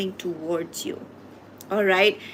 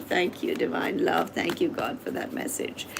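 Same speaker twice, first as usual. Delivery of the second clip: quiet thanks; 4 semitones lower, 7 dB quieter than usual. Real, not acted. Thanking God after a reading.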